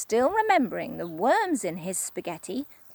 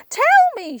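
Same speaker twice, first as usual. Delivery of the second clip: much higher, louder begging cry; Missus Twit crying; 10 dB louder than usual. Acted. Wife begging for the cure, high cry. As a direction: beg urgently. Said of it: "she cried".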